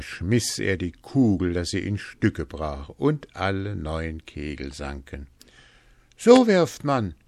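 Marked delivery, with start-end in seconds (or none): 6.2-7.1 high, loud show-off call; triumphant calling out. A narrator's reading. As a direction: dare the others to copy you, loudly. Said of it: "rief er".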